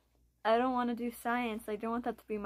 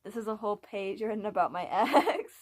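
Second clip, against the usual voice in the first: laughing through the embarrassment, louder toward the end; embarrassed laugh; 5 dB louder than usual. Real, not acted. Poet showing viewers an embarrassing page about an ex, laughing. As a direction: break into a real sheepish laugh on the last word. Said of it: "This is embarrassing as hell".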